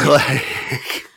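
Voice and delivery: normal talking voice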